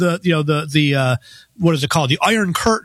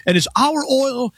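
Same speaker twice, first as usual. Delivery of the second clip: lightly done Bernie voice, higher pitch; Bernie Sanders accent; 7 semitones higher than usual. Retold or imitated. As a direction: ham up an old senator's rally cry, pitched up. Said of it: "I'll use a Bernie Sanders accent".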